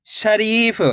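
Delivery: "शरीफ" with the री held long; stretched long ई. Shown as a demonstration. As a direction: stretch the second syllable of "शरीफ", the री. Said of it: "री को खींच के पढ़ना है". ("शरीफ" में री को खींचकर)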